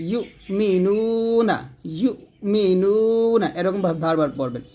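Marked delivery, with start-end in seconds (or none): 0.1-3.5 "ইউমিনুনা" slowly chanted, "নু" held long; stretched নু. Shown as a demonstration. Teacher has learners repeat it, "নু" held long. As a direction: draw out the "নু" in "ইউমিনুনা". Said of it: "নু টান দেবেন এক লিফ".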